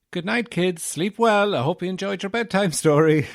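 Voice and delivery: put-on high, loud, sing-song sign-off to kids; exaggeratedly high, loud goodnight to the kids; mock bedtime storyteller. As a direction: chirp it like an over-cheery children's storyteller. Mock sign-off to kids after tale.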